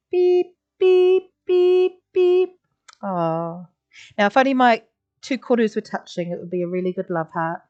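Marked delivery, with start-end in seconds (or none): 0.1-2.6 high, even truck-reversing beeps. Shown as a demonstration. Teacher voicing a reversing truck for the class, high beeps. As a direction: beep like a truck backing up. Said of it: "I'm gonna go around".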